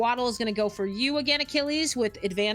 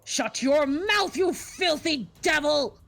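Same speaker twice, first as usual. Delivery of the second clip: slow, loud, furious bellow; angry shout; 4 semitones higher, 3 dB louder, slower than usual. Acted. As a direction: spit it out at the devil, drawn out. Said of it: "The Deva looks at you and goes".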